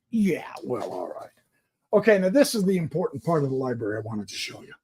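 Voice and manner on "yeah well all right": breathy, voiceless, trailing away; whispered aside. Real, not acted. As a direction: drop to a whisper. Hushed aside after puppy talk.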